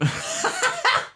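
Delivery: high burst of laughter; laughing. Real, not acted. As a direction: break into a laugh. Laughing at partner's teasing.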